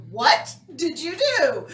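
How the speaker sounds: loud, drawn-out, high question with a laugh; incredulous sister. Retold or imitated. Sister confronting brother over prank call, loud disbelief. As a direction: stretch out the mock accusation.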